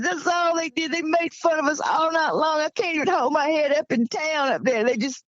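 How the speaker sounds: mildly tearful, wounded protest; retold tearful complaint. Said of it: "He just started crying".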